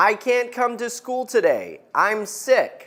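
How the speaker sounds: teacher showing students how to say it; model sentence. Shown as a demonstration. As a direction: say it clearly for the class to repeat. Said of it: "I'll say it one more time and then you say it".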